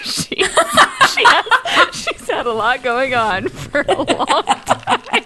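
laughing through the words; words broken by laughter. Real, not acted. Laughing about busy friend.

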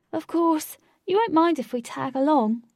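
host assuming they can join the group; cheeky host. A narrator's reading. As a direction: invite yourself along cheekily. Host inviting himself.

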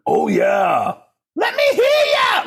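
very high, shouted stage cry; rock frontman impression. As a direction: whoop to the crowd like an over-the-top rock singer.

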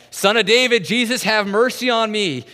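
desperate high shout, full voice; begging scream at the top of the lungs; screaming for mercy. Retold or imitated. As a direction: cry out to be heard over a crowd. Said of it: "he starts screaming".

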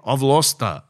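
ordinary delivery

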